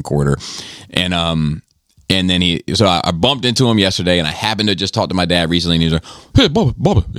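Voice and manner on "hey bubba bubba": Joel's voice. Retold or imitated. Friend imitating Joel calling out to him. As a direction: ham up the old guy greeting you. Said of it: "He talks like this".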